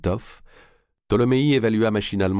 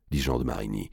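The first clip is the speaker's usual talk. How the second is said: ordinary delivery, nothing marked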